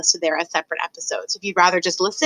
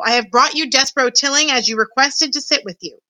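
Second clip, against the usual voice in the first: mildly raised, higher-pitched proclamation; formal calling out; shouted announcement; 6 semitones higher than usual. A narrator's reading. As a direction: present him to the council. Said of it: "shouted Furlow".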